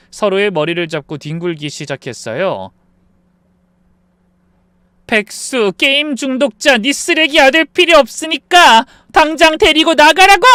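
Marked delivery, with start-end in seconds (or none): 5.1-10.5 insults building to a very loud, high scream; furious yelling. Acted. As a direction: spit out each insult, then explode. Daughter-in-law brawls, orders them out.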